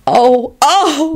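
high breathless gasps of wonder; gasping. A narrator's reading. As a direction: gasp in delight. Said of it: "Lucretia gasped".

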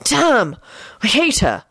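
high, disgusted grumble. A narrator's reading. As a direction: curse the bad luck. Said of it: "barked out in disgust".

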